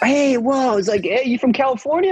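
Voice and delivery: bright, high, eager call to a stranger; eager greeting. Retold or imitated. She greets a stranger she takes for American, eagerly. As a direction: hail him like a long-lost countryman. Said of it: "I was like".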